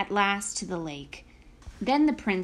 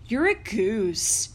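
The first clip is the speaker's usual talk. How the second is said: giant voice; slower than usual. A narrator's reading. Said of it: "said the giant".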